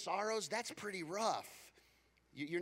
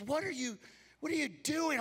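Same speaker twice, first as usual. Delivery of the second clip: surprised wife; 10 semitones higher, 4 dB louder than usual. Retold or imitated. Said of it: "She goes".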